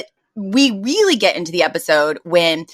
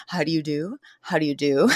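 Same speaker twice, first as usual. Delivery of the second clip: a hint of prim, quiet society manners; mock polite greeting; 4 semitones lower, 6 dB quieter than usual. Fran retold, composing herself before society ladies, mock polite. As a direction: act suddenly proper after screaming. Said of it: "quickly recenters and she's like".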